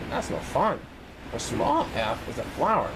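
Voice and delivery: usual speaking voice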